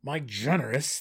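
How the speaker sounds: sarcastic gnome jab; dripping with sarcasm. A narrator's reading. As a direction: lean mockingly on the praise. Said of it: "the word dripped with sarcasm".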